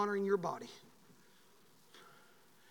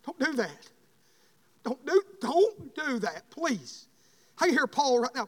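preaching; 8 semitones higher, 9 dB louder than usual. Acted. Preaching sermon.

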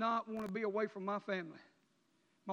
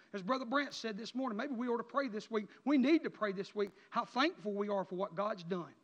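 normal talking voice

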